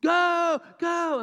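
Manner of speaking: high, loud cheering yell; big sports-fan holler; yelling for team. Shown as a demonstration. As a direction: cheer your team on at full voice. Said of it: "When we're yelling for our team".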